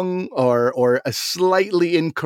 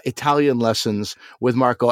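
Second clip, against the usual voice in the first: ordinary talk, nothing marked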